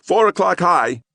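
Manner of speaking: sharp high bark of a call. Acted. Kid barking orders while darting through crowd. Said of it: "one of them barked".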